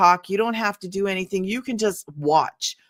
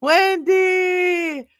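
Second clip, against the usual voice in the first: high, louder call of the name; excited; 10 semitones higher, 5 dB louder than usual. Real, not acted. Host spots viewer Wendy joining, excited call. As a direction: hail her like a friend arriving. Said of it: "I'm sorry if I get excited a little bit".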